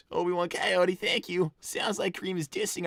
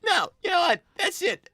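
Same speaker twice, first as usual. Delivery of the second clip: much higher, loud, near-shouting protest; exasperated outburst; 8 semitones higher, 5 dB louder than usual. Real, not acted. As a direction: blow up in real frustration at Mom.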